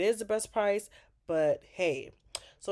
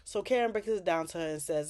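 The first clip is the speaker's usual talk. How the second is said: ordinary delivery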